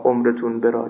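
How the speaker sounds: ordinary talk, nothing marked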